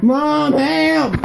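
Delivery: loud, long-held call; real yelling for help. Real, not acted. Pinned wrestler calling to mom, yelling. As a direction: holler out for rescue.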